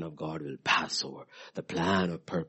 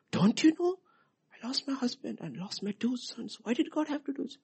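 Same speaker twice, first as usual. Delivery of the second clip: 1 mock complaint. Naomi complaining, voiced high.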